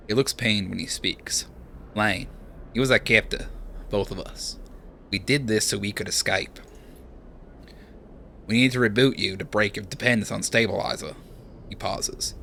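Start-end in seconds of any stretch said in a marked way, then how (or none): 1.9-11.1 put-on light Australian accent, casual; lightly voiced Australian bloke; Aussie accent. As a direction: give him an easy Aussie twang. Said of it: "decided that Seraphin is Aussie".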